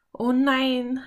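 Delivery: high, loud cry of dismay; appalled inner exclamation; horrified. A narrator's reading. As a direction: react in horror, voice lifting sharply. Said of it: "dachte Philipp entsetzt".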